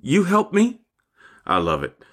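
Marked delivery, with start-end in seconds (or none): 0.0-0.8 put-on high little-child voice, rising at the end; Stormy. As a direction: imitate a small granddaughter asking for help. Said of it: "she will come and say".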